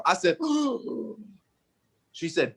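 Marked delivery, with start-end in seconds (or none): 0.4-1.1 sharp gasp. Acted. Gasp at more lines.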